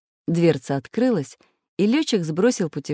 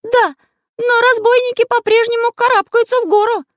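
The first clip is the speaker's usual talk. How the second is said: very high, loud, agitated voice; Карандаш worrying; 9 dB louder than usual. A narrator's reading. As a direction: fret aloud in a squeaky-high pitch. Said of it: "волновался Карандаш". (взволнованно, высоким голосом)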